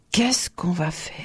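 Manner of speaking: frightened whisper; aghast. A narrator's reading. Tourba asks the count what to do, frightened whisper. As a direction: whisper it in alarm, as if caught. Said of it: "balbutia-t-il, effaré".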